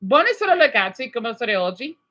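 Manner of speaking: Italian phrase with Italian vowels, brisk start; Italian spoken cheerfully; Italian greeting. Shown as a demonstration. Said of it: "I'll give an example".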